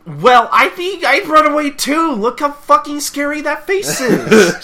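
high, slow, loud squeal; mock fright. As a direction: play scared of the furious face. Mock fright at angry face.

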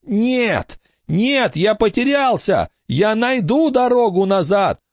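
high frantic protest; desperate wail. A narrator's reading. The ghost crying out in denial. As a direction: refuse in panic. Ghost refusing through Billy.

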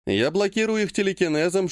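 ordinary delivery, nothing marked

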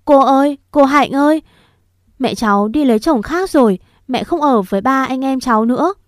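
little niece sobbing that mother left; crying child. Acted. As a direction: wail out the news to your aunt, close to tears. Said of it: "tu lên khóc mếu máo".

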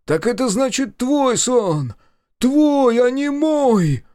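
very high, loud, drawn-out cry; stretched, high-pitched outraged shout; accusing scream. A narrator's reading. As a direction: hurl the accusation slowly at full voice.

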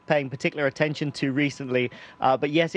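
normal talking voice

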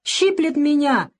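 put-on high, drawn-out child's wail; whimpering lament. A narrator's reading. Boy complains as the doctor cleans his grazes, wailing. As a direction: wail like a small boy being stung. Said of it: "запричитал тот".

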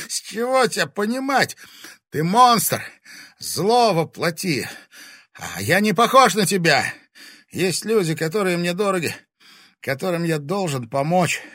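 high, loud accusation; impassioned defiance. A narrator's reading. Dying Timofey denouncing the monster, impassioned and loud. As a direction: hurl it at the monster. Тимофей гневно обличает монстра.

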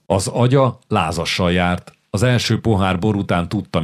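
ordinary delivery

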